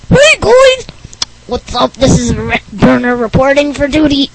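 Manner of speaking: Rick Berner voice. Acted. Kid barging into the podcast, loud and goofy. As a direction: burst in as the pushy neighbor kid.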